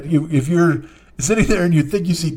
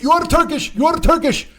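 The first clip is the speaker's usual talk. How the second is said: put-on much higher, frantic accented yell; accented screaming; 14 semitones higher than usual. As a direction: drunk passenger screaming the accusation. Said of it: "he starts screaming at".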